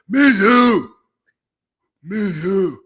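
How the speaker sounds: high, loud croaky mock voice; hammed-up imitation of a damaged voice; Bobby impression. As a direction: squeeze out a strained, high rasp. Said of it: "when I do Bobby".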